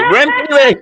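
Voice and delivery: high excited yell with a laugh; screaming at the screen for the runner; mock yell. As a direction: cheer him on like a fan at the TV.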